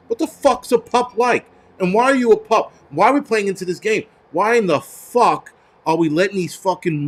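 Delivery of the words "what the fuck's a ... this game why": high-pitched baffled demand; exasperated, pitch leaping up; incredulous ranting. Real, not acted. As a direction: question it in utter disbelief. Ranting at the quoted pronoun.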